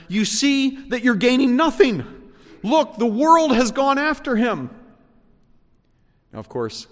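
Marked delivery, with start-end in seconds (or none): 0.1-4.7 much higher, louder worried voice; alarmed Pharisee. Retold or imitated. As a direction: voice the panicked plotters. Pharisees quoted plotting.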